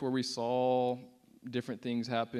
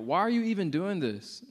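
higher-pitched retold outcry; crying out in protest; 6 semitones higher, 3 dB louder than usual. Atheist crying out at Jesus on the cross, mock yell. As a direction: imitate an atheist crying out in disbelief. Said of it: "this atheist crying out".

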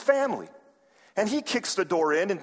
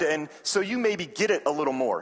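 normal talking voice

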